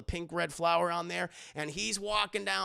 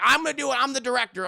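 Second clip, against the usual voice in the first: louder, higher bossy insistence; insistent Steven; 5 semitones higher, 8 dB louder, much faster than usual. Retold or imitated. Steven overruling George, loud and bossy. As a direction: pull rank as the director.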